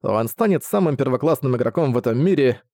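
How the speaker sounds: ordinary delivery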